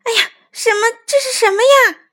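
high, loud shocked exclamation; teacher's alarmed yelp; startled alarm. A narrator's reading. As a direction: cry out as if you just spotted a mouse. Teacher startled by seeing a mouse.

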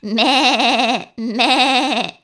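loud, high quavering bleat; drawn-out, wavering baa; sheep bleat. A narrator's reading. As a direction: imitate a sheep with a strong wobble. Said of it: "bleated the sheep".

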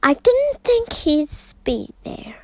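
whispered, embarrassed and sheepish. A narrator's reading. Mrs Brown to the family, whispering sheepishly. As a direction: breathe it out like you've been caught. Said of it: "looking very sheepish".

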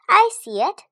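high, loud excited call. A narrator's reading. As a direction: call it out to the class. Classmate answering excitedly.

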